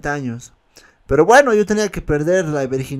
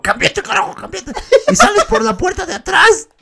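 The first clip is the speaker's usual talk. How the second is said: much higher hurried mock husband, laughing; husband's order. The husband ordering the boy out the back, laughing mock voice. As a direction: bark the order at the kid. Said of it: "su esposo ... me dijo".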